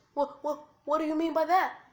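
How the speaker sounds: high, loud, startled stammer; alarmed. Acted. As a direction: blurt it out in alarm. Naruto alarmed by the fox's threat.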